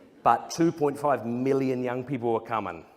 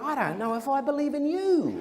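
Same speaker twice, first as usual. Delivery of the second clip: much higher cheeky reply with a laugh; mock retort; 10 semitones higher than usual. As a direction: turn the question back on the asker. Retort to someone asking about Jesus.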